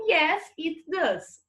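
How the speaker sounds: slow, clear short answer; teacher slowly models the answer. Shown as a demonstration. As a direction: lay out each word for the class. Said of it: "E ele canta".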